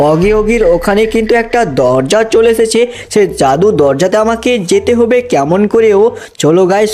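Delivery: ordinary talk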